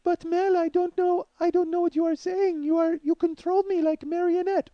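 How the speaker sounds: put-on high, meek Russian accent; mock Russian accent, high and plaintive; Russian mail-order bride. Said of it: "Russian mail order bride voice".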